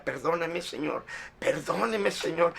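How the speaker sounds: a broken, hushed plea for forgiveness; súplica llorosa. Retold or imitated. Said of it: "Yo lloraba y lloraba". (súplica entre lágrimas, en voz baja)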